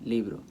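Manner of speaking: "libro" said as LI-bro, falling away; stress on LI. Shown as a demonstration. Teacher models the correct pronunciation, stress on LI in "libro". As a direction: stress the first syllable of "libro". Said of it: "That's how you pronounce it".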